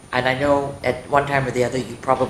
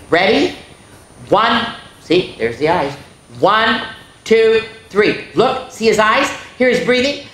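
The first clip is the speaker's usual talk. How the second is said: nervous countdown; 6 semitones higher, 4 dB louder than usual. Acted. Boy counts to jump.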